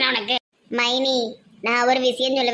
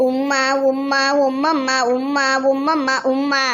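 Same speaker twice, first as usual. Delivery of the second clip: kissing sounds; slower than usual. Retold or imitated. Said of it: "அவனுக்கு போய் உம்மா கொடுத்துட்டு இருக்க".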